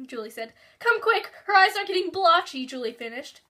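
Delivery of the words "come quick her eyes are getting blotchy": urgent panic. Acted. Mother urging emergency help.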